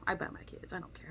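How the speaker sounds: a hushed, unvoiced admission; whispered confession. Real, not acted. Admitting to viewers she bites her kids, whispered. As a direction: whisper it like a guilty secret.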